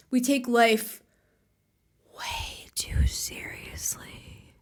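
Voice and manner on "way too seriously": slow, hushed whisper; whispered punchline. Real, not acted. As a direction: draw it out in a whisper.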